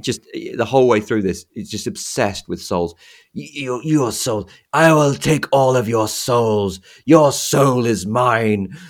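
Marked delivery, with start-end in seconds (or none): 3.3-8.8 slow, louder sinister sorcerer; Shang Tsung voice. Retold or imitated. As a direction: drag it out like a gloating villain. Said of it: "we talked about Shang Tsung".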